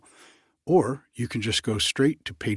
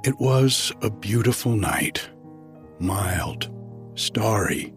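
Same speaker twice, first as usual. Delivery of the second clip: story narration. Acted. Begins reading the story.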